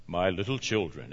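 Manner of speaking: a hint of a booming radio-show voice; James Earl Jones. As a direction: drop the voice low like an old radio drama. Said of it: "sounded like James Earl Jones".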